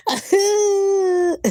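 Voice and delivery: put-on high hooting baby laugh; mock high baby hoot; imitating baby laugh. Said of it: "he would just be like".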